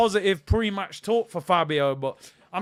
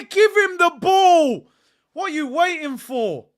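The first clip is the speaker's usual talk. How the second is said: frustrated yell; 10 semitones higher, 10 dB louder than usual. Real, not acted. Frustrated fan yelling at player.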